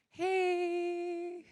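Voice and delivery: high, drawn-out tentative greeting; timid, scared. Retold or imitated. Girl reconnecting, afraid of being rejected, acted timid. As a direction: stretch it thin and high, as if testing the water. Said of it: "super scared I'm gonna reject her".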